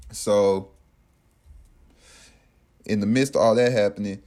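ordinary delivery, nothing marked

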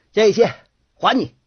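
high, loud bellow thrown out in short bursts; defiant shout. A narrator's reading. Ma San throwing money back at Hai Ye, shouting. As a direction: shout it at the top of your lungs. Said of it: "扯着嗓门嚷道".

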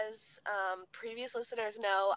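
usual speaking voice

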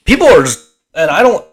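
talking through a yawn; stretched words mid-yawn. Real, not acted. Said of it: "again, I'm yawning again".

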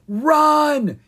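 high, loud yell to run. Acted. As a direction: shout the warning, urgent. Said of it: "he yelled".

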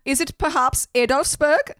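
put-on high, playful Swedish accent sliding toward German; mock Swedish-German accent. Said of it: "That's getting a little German".

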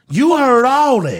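loud, slow, drawn-out insistence; emphatic insistence. Real, not acted. Host insisting his co-host heard it, emphatic insistence. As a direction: press the point loudly and slowly.

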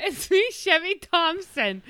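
menacing villain. Acted. Villain repeats his entrance.

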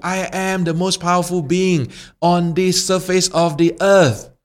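high, grandiose boasting voice; boastful fire. Acted. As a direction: boast like a tiny tyrant.

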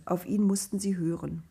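ordinary delivery

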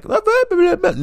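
high squeaky mock voice; mock the listener's whining; a high sing-song blah blah. Mocking imagined objection.

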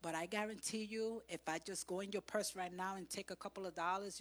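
usual speaking voice

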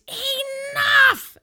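full-throated, very high scream; furious scream. Retold or imitated. Mom-like screamer halting a graveside brawl, huge high scream. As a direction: scream it like a mom at her limit. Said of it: "but I screamed".

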